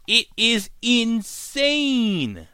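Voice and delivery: high, slow, drawn-out amazement; stretched-out awe. Real, not acted. Vlogger marveling at the twist, slow and high. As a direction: let the word insane hang in awe.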